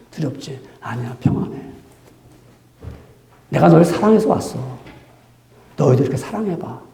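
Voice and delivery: quiet tender voice of Jesus comforting his disciples. Acted. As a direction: hush them with quiet reassurance.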